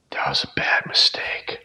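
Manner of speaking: hushed, voiceless imitation of a shaken friend; retold whisper. Friend confessing the edible went badly, imitated whisper.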